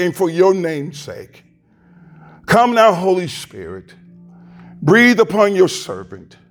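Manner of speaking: earnest pastoral prayer, unhurried with pauses. Acted. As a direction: pray it aloud over the congregation. Pastor prays before the sermon.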